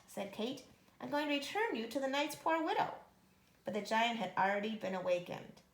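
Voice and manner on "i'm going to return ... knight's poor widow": fast, higher urgent promise; hurried reassurance. Acted. Kate fleeing with the harp, fast urgent reassurance. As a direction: soothe it while running.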